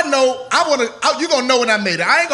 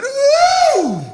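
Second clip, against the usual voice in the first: high pained cry; 5 dB louder than usual. Retold or imitated. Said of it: "somebody said".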